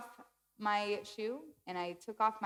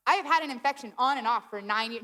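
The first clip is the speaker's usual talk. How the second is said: loud, insistent self-justification; defensive; 3 semitones higher, 7 dB louder, faster than usual. Retold or imitated. Patient contradicting her doctor, retold defensive protest. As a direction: insist on it, as if disbelieved. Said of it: "defensive that he's thinking".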